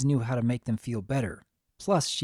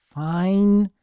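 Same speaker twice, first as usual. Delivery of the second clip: grudging; 7 semitones higher, 6 dB louder than usual. Acted. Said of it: "Violet groaned".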